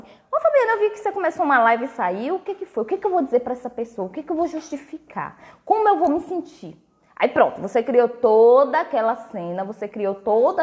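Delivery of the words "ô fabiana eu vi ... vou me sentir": high, quick imitation of someone stopping her in the street; lightly voiced busybody asking what happened; mock nosy acquaintance. Quoting someone who stops her on the street to pry, high and quick. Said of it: "vai falar assim".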